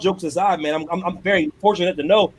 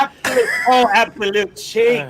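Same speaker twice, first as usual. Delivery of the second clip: mock British commentator. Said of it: "the Brits are like".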